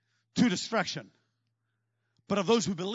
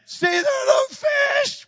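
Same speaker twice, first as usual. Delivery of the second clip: much higher, louder, slow and taunting; sarcastic shout; 9 dB louder, slower than usual. Acted. Peter shouts back to Jesus that there are no fish, mocking. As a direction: crow it out like you've proved a point.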